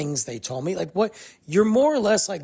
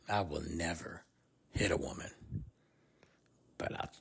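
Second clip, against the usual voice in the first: a lightly voiced comedian quote, much lower and softer; Chris Rock bit; 11 semitones lower, 5 dB quieter than usual. Quoting Chris Rock's joke about fighting back, low and hushed. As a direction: toss off the joke low and hushed, ending in a whisper. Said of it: "what did Eddie Murphy say? No, Chris Rock said that".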